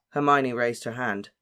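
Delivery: ordinary delivery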